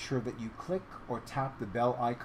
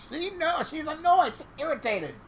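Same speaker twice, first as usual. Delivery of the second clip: very high squeal of amusement; gleeful laughing; 6 dB louder than usual. Real, not acted. Laughing at annoyed cat.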